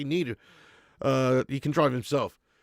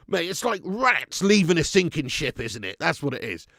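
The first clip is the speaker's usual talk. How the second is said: ordinary talk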